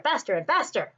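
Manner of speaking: ordinary delivery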